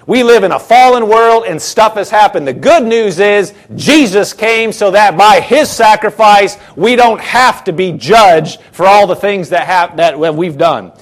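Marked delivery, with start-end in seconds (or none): none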